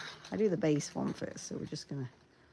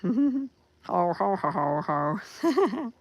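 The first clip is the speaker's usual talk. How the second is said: hearty ho ho ho; 5 semitones higher, 5 dB louder, much slower than usual. Acted. Mock laugh.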